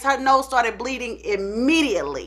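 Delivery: ordinary delivery, nothing marked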